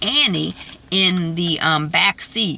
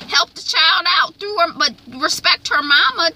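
pitched-up, brisk scolding social worker; high lecturing voice; 7 semitones higher than usual. Acted. Social worker scolds the foster parents, high and brisk. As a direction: lecture them like a stern caseworker, up high. Linda lecturing Randall and Beth.

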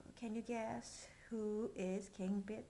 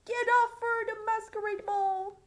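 high loud party call; excited court call; 9 semitones higher, 10 dB louder than usual. Acted. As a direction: call them to the ball. Court calling out.

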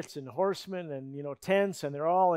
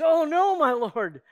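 much higher, louder panicked outburst; panicking; 8 semitones higher, 6 dB louder, slower than usual. Acted. Servant crying out to his lord, freaking out. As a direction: act out freaking out. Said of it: "He's panicking, freaking out".

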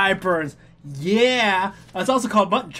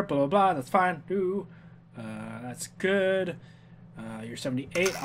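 ordinary talk, nothing marked

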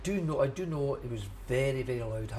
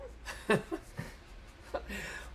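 soft airy chuckle; breathy laugh; 8 semitones higher, 4 dB quieter than usual. Real, not acted. After customers call his accent rubbish, a quiet breathy laugh.

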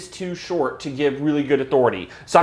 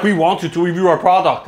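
put-on rough comic German accent; attempted German accent; 4 dB louder than usual. Reviewer imitates the yoke makers, amateur German accent. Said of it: "That's my attempt at a German accent".

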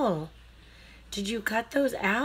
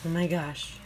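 usual speaking voice

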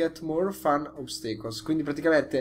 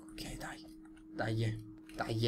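tense whisper; 4 semitones lower, 13 dB quieter, slower than usual. Real, not acted. Tense moment in game.

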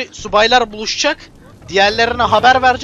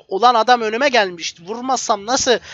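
ordinary talk

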